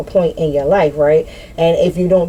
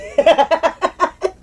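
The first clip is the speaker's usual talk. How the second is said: long high cackle trailing into wheezes; high helpless laughter; 8 semitones higher than usual. Real, not acted. Laughing at her own crying act, high helpless laughter. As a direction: crack up helplessly at yourself.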